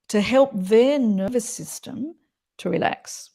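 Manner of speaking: ordinary talk, nothing marked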